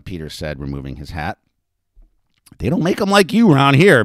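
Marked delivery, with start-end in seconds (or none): 2.6-4.0 loud, high-pitched delight; delighted proclamation. A narrator's reading. Betty admiring the newcomer in the street, delighted proclamation. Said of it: "Betty proclaimed with delight".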